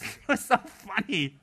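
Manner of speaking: real laughter through the words, slow and high; giggling helplessly at the memory; laughing. Real, not acted. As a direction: laugh it out as you say it.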